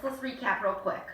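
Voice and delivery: usual speaking voice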